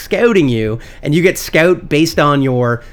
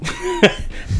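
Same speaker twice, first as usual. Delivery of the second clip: burst of laughter; 5 dB quieter than usual. Real, not acted. Laughing at own joke.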